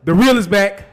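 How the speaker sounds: loud triumphant declaration; loud announcement. Acted. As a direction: announce the return proudly.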